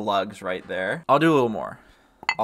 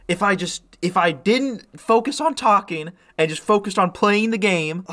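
normal talking voice

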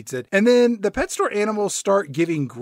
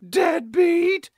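put-on high kid voice sneaking an insult; muttered cough insult; 13 semitones higher, 4 dB louder than usual. Mall rep insults store owner after throat-clear, high voice. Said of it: "deadbeat under his breath".

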